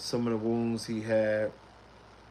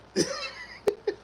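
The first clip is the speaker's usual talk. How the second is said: high wheezing laugh; helpless high-pitched giggle fit. Retold or imitated. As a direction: crack up, unable to stop. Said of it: "I can't help it".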